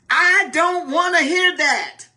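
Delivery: high, curt brush-off; dismissive spouse. Pretend. Spouse brushing off their partner, dismissive. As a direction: play the spouse who shuts you out.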